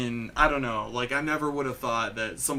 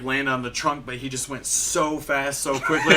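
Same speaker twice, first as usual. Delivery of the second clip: usual speaking voice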